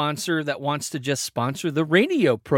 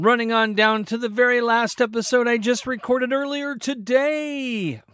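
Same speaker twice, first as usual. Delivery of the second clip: normal talking voice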